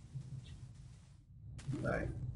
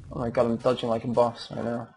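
ordinary talk, nothing marked

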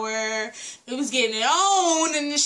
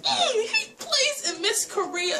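excited; 10 semitones higher than usual. Real, not acted. Calmer repeat of recognition.

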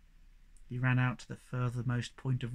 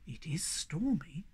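slow, hushed, near-whisper; grave pronouncement; 7 dB quieter, slower than usual. A narrator's reading. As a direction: deliver it softly and ominously. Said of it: "put his face into a serious crease".

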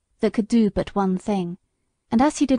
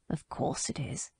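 whispered; 7 semitones lower, 14 dB quieter than usual. Acted. Old horse's grim reply.

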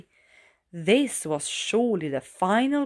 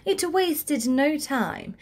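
usual speaking voice

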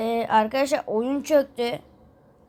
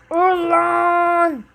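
higher, louder "die" shout; angry yell; 5 semitones higher, 7 dB louder than usual. Acted. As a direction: spit it at the foe. Yelling at enemy.

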